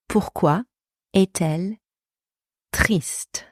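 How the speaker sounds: one chunk at a time. Shown as a demonstration. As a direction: pause after every piece so learners follow. Teacher breaks down model phrase.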